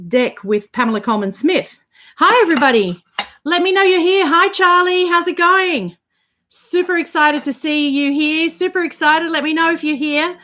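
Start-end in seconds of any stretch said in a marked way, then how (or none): 2.2-10.4 high, upbeat hellos to chat; cheery viewer greeting. Real, not acted. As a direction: greet everyone brightly. Greeting viewers joining live.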